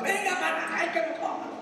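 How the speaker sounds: high mock yell, shoe in hand; mom yelling. Mother threatening her child with a shoe, yelling. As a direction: call the kid over like an angry mother. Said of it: "persiguiendo al chino y gritando".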